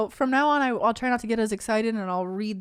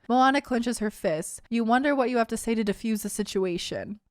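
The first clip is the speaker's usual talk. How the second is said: ordinary talk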